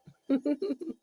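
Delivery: a short high-pitched laugh. Real, not acted. Reader laughing at her own gory image, high giggle. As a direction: burst into a quick high giggle.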